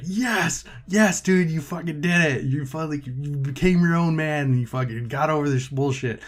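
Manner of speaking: high, exultant cheer for a buddy; triumphant cheering. Acted. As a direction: cheer him on like his biggest fan. Acting out a viewer cheering him on.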